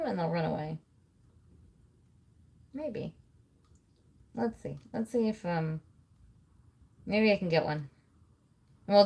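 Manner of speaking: normal talking voice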